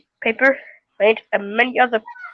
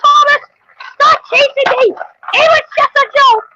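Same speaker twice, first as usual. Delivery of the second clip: high, louder mock crying yell; frantic high crying shout; 6 dB louder than usual. Toy engine wailing as Thomas chases it. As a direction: wail and plead, hammed-up.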